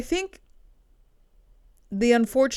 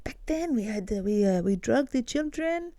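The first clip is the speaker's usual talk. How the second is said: Polanski impression in a bad French accent; hammy French accent, a little higher; 5 semitones higher than usual. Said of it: "not even a good French accent".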